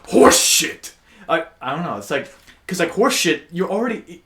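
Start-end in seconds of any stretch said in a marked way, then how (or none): 0.1-0.9 loud, harsh-edged, hissed-out curse; aggressive. Shown as a demonstration. Friend demonstrating a favourite swear, aggressive. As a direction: spit the word out forcefully. Showing how aggressive the word is.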